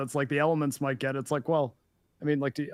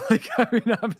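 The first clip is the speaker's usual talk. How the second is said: laughing; 9 semitones higher, 6 dB louder than usual. Real, not acted. Laughing mid-sentence.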